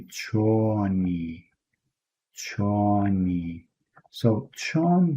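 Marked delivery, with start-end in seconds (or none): 0.0-3.6 "choni" drawn out slowly, cho-ni; Kurdish choni. Shown as a demonstration. Teacher models Kurdish how are you, slow and clear. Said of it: "you say".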